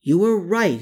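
high, animated agreement; exclaiming. A narrator's reading. Man conceding she was right, exclaiming. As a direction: exclaim back in eager agreement. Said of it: "he exclaimed back".

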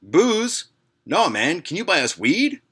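put-on high, eager teen asking; eager skater kid. Skater kid asking the narrator for weed, high and eager. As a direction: pitch it up like a keen teenager. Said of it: "and says".

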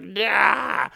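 high, loud imitation of a screaming old lady; mock scream. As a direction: yell out a short wordless screech in imitation. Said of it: "she's just yelling and screaming".

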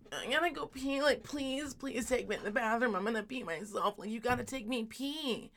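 imitating a kid's urgent whine; pleading little boy. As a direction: plead like a desperate kid. Little boy begs to pee.

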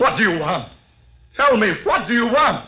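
very high, loud panicked yells; terrified shouting. Acted. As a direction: scream at the empty room in terror. Shouting at the ghost.

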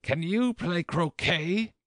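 high, drawn-out shouted question; high, slow royal holler. A narrator's reading. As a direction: shout the question as an imperious queen. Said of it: "shouted the queen".

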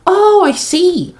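very high, drawn-out slur; Komori, slurred. A narrator's reading. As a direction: slur it slowly in a squeaky little voice. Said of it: "Komori slurred".

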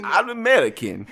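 hammed-up Borat-style accent, laughing; a laughing mock Borat. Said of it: "That's Borat".

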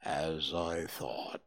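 very low, slow and mournful Eeyore; gloomy donkey. A narrator's reading. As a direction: drag it out like a sad old donkey. Eeyore speaks, read aloud.

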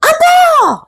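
high, loud excited shriek; shrieking cats. A narrator's reading. Cats shrieking with joy at the ball. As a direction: squeal it out like thrilled cats. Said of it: "shrieked the cats".

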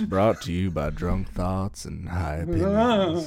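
a touch of a deep commercial voiceover; announcer voice. Shown as a demonstration. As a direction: boom it like an ad announcer. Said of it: "retaking the sponsor line".

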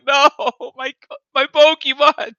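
high, loud mock wail with laughter; hammed-up cry of a devastated child; mock traumatized wail. Child who dropped his Game Boy, mock traumatized wail. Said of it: "I was just, like, traumatized".

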